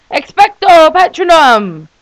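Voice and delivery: slow, loud, high dramatic spell; spell casting. Acted. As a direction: cast the spell with flair. Acting out Harry Potter reading.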